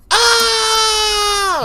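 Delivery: squeaky high yelp of a monster caught off guard; high mock shriek. The monster getting its eyes poked, high mock scream. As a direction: shriek it high and helpless like a startled creature. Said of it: "he'll just go".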